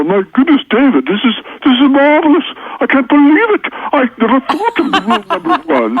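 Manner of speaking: high, hammed-up British gushing; a delighted British reader, overdone and squeaky; bad British accent. British reader praising rule one, in a high mock British accent. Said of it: "I can't do a real good British accent".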